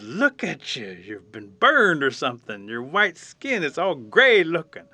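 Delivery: slow, drawn-out mock amazement; astonished exclamation. Animals see the burned raccoon, acted astonishment. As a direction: exclaim at a startling sight.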